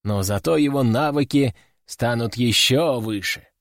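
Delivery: usual speaking voice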